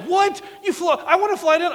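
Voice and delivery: high, loud mock outrage from the wife; wife's indignant protest. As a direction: squeal it as his jealous wife. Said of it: "my wife's like".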